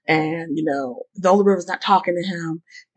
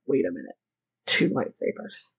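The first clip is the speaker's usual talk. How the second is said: hushed realization; 8 semitones lower, 8 dB quieter than usual. Retold or imitated. Schemer in the mask discovering the two lightsabers, whispered. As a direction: breathe it out like a secret find.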